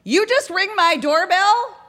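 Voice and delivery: high, drawn-out call from a window. Acted. Woman upstairs to door knockers, calling out high. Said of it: "a woman calling out her window".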